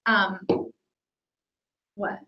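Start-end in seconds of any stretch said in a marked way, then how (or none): none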